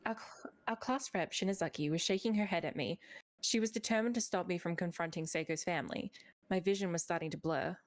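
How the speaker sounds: low, quiet story reading; calm narration of a novel. Acted. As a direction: read the chapter in a narrator voice. Reads a story passage.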